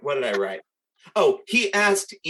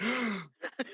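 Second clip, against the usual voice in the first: mock gasp; 9 semitones higher, 7 dB quieter than usual. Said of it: "this shocker".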